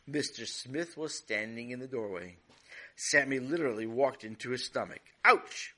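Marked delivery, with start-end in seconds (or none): 5.2-5.7 sharp high loud ouch; startled grown-up bumped into. Acted. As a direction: yelp as if bumped in the stomach. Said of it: "Mister Smith said".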